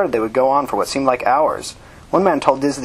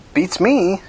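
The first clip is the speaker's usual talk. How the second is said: higher-pitched verbal shrug; shrugging; 8 semitones higher, 3 dB louder than usual. A narrator's reading. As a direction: toss off a clueless reply. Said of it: "shrugged Diz".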